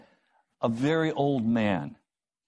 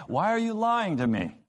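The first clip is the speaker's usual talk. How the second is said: high, pointed challenge; accusing; 10 semitones higher than usual. Acted. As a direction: catch them out in the lie. Acted confrontation over a lie.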